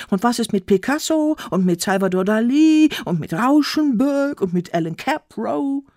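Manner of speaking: loud, high-pitched cry building in excitement; shouting. A narrator's reading. Friends shouting round the weaver about famous artists, shouted loud and high. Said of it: "die Freunde um mich herum schrien".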